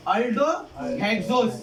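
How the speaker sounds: drawn-out aldohexose, each syllable slow; slow spelled-out term. Shown as a demonstration. Teacher to class, slowly drawing out the new term. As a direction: stretch out aldohexose so students catch it. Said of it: "इसको हम लोग बोलेंगे".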